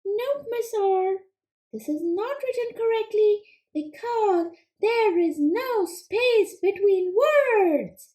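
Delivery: high, quick little frog puppet, eager to answer; squeaky-high puppet frog pleased with itself; Froggy puppet voice. Acted. Frog puppet answering the teacher, high and eager.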